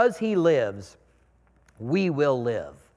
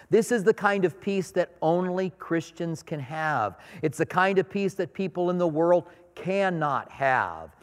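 ordinary delivery

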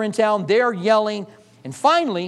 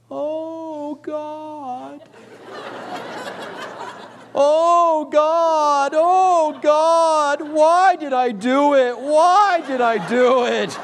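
put-on wailing child; 7 semitones higher, much slower than usual. Son wailing from back room.

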